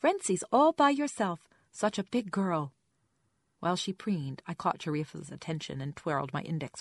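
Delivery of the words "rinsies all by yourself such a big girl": put-on slow, high, loud toddler praise; pleased, lifted voice. A narrator's reading. Mother praises her toddler's rinsing, pleased lifted voice. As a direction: draw it out high so the child hears delight. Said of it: "I lifted my voice a half octave".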